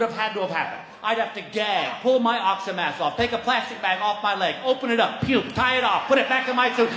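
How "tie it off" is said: ordinary talk, nothing marked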